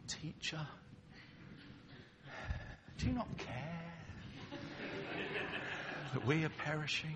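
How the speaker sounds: a mild, respectful request to the teacher; soft polite plea. Shown as a demonstration. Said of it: "I don't think you speak saying".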